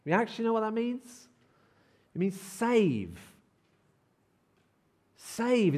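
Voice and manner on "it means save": normal talking voice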